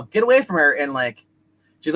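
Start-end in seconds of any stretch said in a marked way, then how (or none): 0.0-0.7 high, loud retold command; imitated warning shout. Lesla as robot grabs her, imitated high warning shout. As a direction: call it out higher and louder, as a mimicked warning. Defending someone from grabbing robot.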